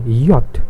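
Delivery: Yacht said as "yot", crisp final t; Yacht as the German name of J; German letter J. Shown as a demonstration. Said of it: "That's why I've written it".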